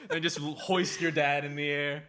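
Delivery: ordinary delivery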